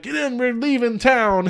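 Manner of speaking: high, slow getaway line; urging friends to leave fast; acting out a quote. Friend tells a buddy the movie line, high and slow. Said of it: "they're like".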